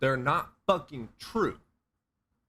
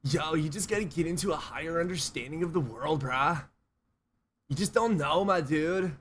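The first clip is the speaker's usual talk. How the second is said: stoner guru voice; 5 semitones higher than usual. Retold or imitated. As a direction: preach cosmic wisdom like a stoned bro. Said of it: "I used to talk like that".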